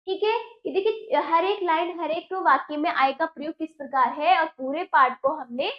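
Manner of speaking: normal talking voice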